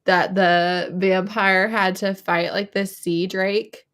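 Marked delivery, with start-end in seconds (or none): none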